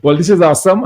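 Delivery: excited. Real, not acted. Host excited after interview.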